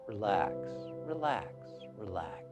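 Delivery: soft, fading repeats with pauses; soothing repetition. Shown as a demonstration. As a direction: let each repeat sink until it is very quiet. Sends a soothing thought to body.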